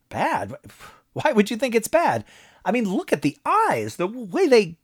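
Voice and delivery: a breezy high-pitched promoter pitching ideas; cheerful businessman Moss. A narrator's reading. Moss enthusing.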